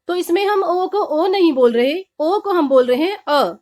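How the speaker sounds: usual speaking voice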